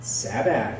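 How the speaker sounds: "Sabag" with a b and hard g, low and firm; correct pronunciation. Retold or imitated. Student insisting on the right name, "Sabag" low.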